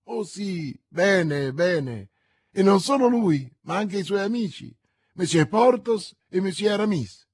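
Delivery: name them proudly and eagerly; eager novice. A narrator's reading. The novice boasting of her friends, eager and excited.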